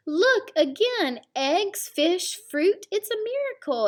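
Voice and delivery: high, loud amazed exclaim; excited exclaim. Acted. Mama discovering food everywhere, high and amazed. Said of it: "exclaimed Mama".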